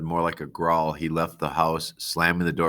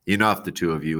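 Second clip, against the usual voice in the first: ordinary delivery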